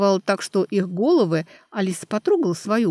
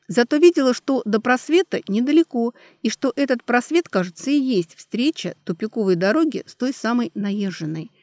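ordinary delivery, nothing marked

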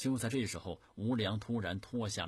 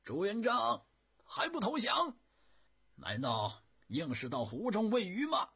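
loud, much higher roar across the water; bellowed threat; 13 semitones higher, 8 dB louder than usual. A narrator's reading. Enemy general shouting at the flagship, threatening bellow. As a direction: bark the challenge to surrender. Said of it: "大声地对船上喝道".

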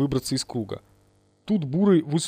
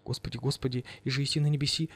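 muttering; 9 dB quieter, faster than usual. A narrator's reading. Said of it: "забормотала дура".

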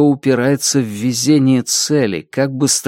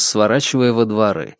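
usual speaking voice

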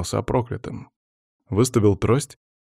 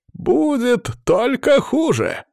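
very high, slow, loud wail; panicking; 4 dB louder, much slower than usual. A narrator's reading. Cursed man warning the guards, panicking. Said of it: "продолжал паниковать мужчина".

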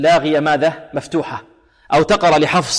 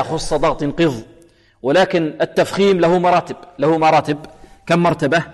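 ordinary talk

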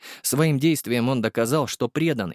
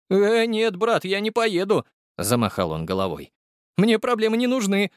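high, gossipy driver voice; the driver Igor bringing Borya the news, high and chatty; chatty young driver; 10 semitones higher than usual. Acted. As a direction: rattle it off, pitched high. Igor refuses.

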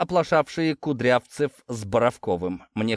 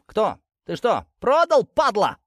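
high, louder desperate squeal; desperate shriek; 9 semitones higher, 4 dB louder than usual. A narrator's reading. Traitor shrieking at his betrayer, high desperate squeal. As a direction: shriek in panic at being betrayed. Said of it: "Последнее он взвизгнул отчаянно".

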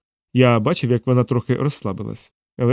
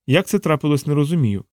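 ordinary delivery, nothing marked

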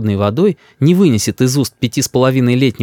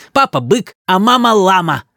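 high teasing chant; mock your parents like a brat; high-pitched little boy's jeer; child's taunt; 8 semitones higher than usual. A narrator's reading. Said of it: "корчит нам рожей Рис".

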